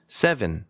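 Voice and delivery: "seven" with a full "von" ending; wrong second vowel. Shown as a demonstration. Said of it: "seven이라고 하면 또 안 돼요".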